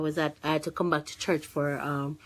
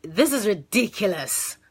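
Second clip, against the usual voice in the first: exasperated; 4 semitones higher, 3 dB louder than usual. Retold or imitated. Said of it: "that was my reaction".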